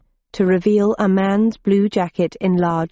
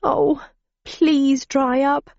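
high, slow, crying plea; tearful; 6 semitones higher, much slower than usual. Acted. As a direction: sniff through tears. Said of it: "started sniffing as large, salty tears".

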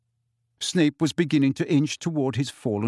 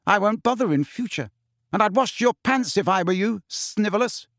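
higher, cool put-down; 7 semitones higher than usual. A narrator's reading. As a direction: dismiss him with cool disdain. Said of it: "she said coolly".

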